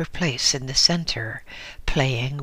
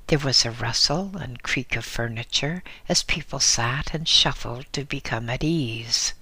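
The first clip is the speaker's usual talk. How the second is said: ordinary delivery, nothing marked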